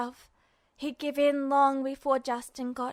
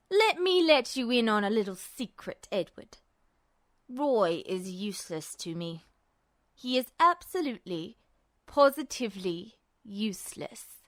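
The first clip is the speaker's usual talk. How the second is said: menacing villain; 5 semitones lower than usual. A narrator's reading. Justin taunting his captive Ed, low amused menace. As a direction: purr the threat like a cat toying with prey.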